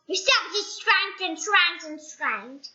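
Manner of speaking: ordinary delivery, nothing marked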